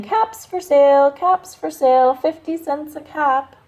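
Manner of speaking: peddler's singsong street call. A narrator's reading. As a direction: call out your wares to the town.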